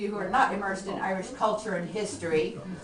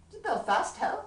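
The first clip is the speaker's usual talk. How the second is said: surprised question; 13 semitones higher, 4 dB louder than usual. Acted. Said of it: "quite astounded".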